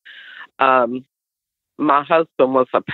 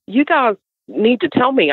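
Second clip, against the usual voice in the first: pleading; 9 semitones higher than usual. Retold or imitated. Wife begging them to explain.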